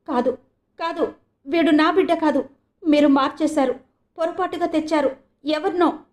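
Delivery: put-on a mother screaming that the baby is not hers; frantic cry. A narrator's reading. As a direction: cry out wildly in disbelief. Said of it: "అంటూ పిచ్చిగా అరిచింది".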